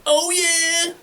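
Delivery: high, loud rocker wail. Shown as a demonstration. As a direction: wail it like a rock frontman. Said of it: "You're like".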